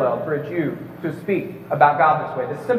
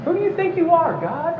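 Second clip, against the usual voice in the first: much higher, mock-indignant "who do you think you are"; incredulous challenge; 10 semitones higher than usual. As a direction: throw the question back in scornful disbelief. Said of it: "I want to say to this Jesus".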